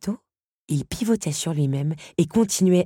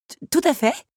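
high, loud stammered agreement; flustered stammer; 12 semitones higher, 6 dB louder than usual. A narrator's reading. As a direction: stumble on the first sound, voice jumping up. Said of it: "balbutia-t-elle".

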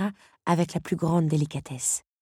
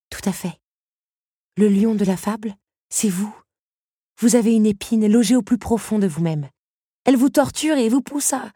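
put-on higher, louder young woman insisting; Belle pressing her point; 7 semitones higher, 7 dB louder than usual. Belle lecturing the beast about his thorn, insistent. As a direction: push the lesson home, earnest and firm.

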